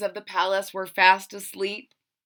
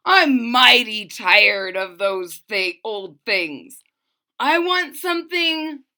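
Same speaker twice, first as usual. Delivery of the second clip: storybook king bellowing; 3 semitones higher, 7 dB louder, slower than usual. Acted. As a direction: bellow it out in exasperation. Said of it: "bellowed the king".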